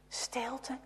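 hushed dramatic whisper; softly whispered silence. A narrator's reading. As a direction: breathe the word into silence.

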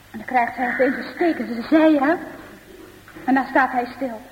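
ordinary delivery, nothing marked

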